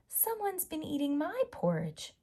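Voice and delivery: high, whispered Mommy Bear gasp; startled mother bear finding her porridge; Mommy Bear exclaiming. Acted. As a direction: breathe it out in shock. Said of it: "she exclaimed".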